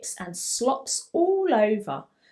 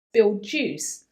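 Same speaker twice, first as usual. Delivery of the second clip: ordinary delivery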